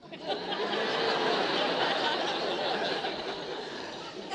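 long breathy laugh at his own answer; delighted laugh. Real, not acted. Preacher laughing with congregation over his answer, amused. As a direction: laugh at the cleverness of the reply. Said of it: "It's quite a smart answer".

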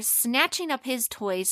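ordinary delivery, nothing marked

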